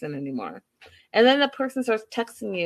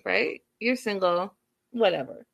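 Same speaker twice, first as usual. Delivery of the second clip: usual speaking voice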